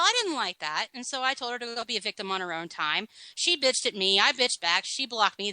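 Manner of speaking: normal talking voice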